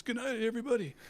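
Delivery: high, ringing call to the crowd; stage farewell. Retold or imitated. Frontman calling goodnight to the crowd, high and loud. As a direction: sign off like a frontman to a packed hall.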